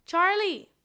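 high, loud name call; joking call-out. Retold or imitated. Coworker calling a TikTok star as a joke, shouted. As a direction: holler the name playfully. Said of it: "like, as a joke".

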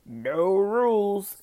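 drawn-out emphatic no rules; slow loud punchline. Acted. Host mocking the show's motto, slow and loud. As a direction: stretch it out loud like a slogan.

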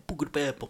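Buca di Beppo sung out in an exaggerated Italian lilt. Shown as a demonstration. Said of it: "You gotta say".

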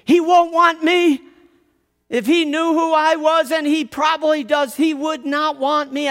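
a cowering outcast pleading his unworthiness; pitiful Shame voice. Retold or imitated. Cellar Bart tells the narrator no one wants him, high and wounded.

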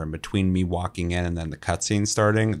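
usual speaking voice

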